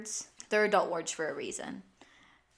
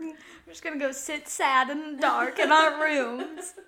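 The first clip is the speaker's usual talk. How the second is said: higher, slow, hammed-up gloom; mock gloomy; 7 semitones higher, 5 dB louder than usual. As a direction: drag it out like a melodramatic sulk. Friend joins the sad-mom joke.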